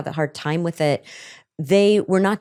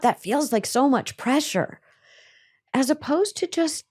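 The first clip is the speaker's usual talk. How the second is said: ordinary delivery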